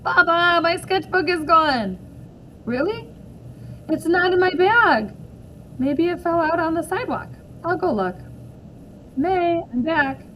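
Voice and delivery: put-on a girl telling her dad something's wrong; upset calling out. May and father search for sketchbook.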